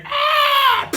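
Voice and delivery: loud high scream; high falling scream. Acted. Gnome falling into the jar, loud high scream.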